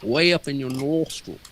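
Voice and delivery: squeezed up into the nostrils; nasal Michael Caine. Shown as a demonstration. Imitating Michael Caine for a friend, all nostrils. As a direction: jam it up in the nose like Caine. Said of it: "it's all up in the nostrils".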